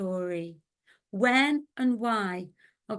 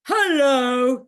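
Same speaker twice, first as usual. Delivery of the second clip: big bear's loud hello; 3 semitones higher, 8 dB louder than usual. Acted. Teacher as the bear greeting in the story, very loudly. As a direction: call out hello at full volume. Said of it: "he said very loudly".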